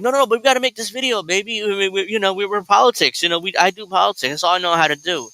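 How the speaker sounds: hammed-up eager husband; mock pleading husband. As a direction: wheedle like a husband who only knows politics. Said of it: "Kyle's like".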